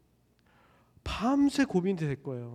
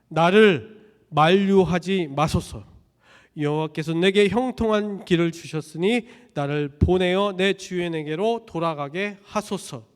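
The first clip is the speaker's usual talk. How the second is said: loud, slow, emphatic proclamation; preacher voicing the old servant, loud and slow; forceful scripture reading; 7 dB louder than usual. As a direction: declare it from the pulpit.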